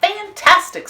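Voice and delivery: high, loud, gushing praise; fake admiration. Princess flattering the dragon, gushing. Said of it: "said Elizabeth".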